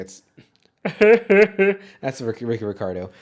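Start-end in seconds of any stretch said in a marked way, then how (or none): 0.8-1.9 a loud high put-on cackle; Eddie Murphy laugh. Loud Eddie Murphy laugh performed for the cohost. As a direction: belt out a big celebrity laugh. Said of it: "I can do it. Here we go".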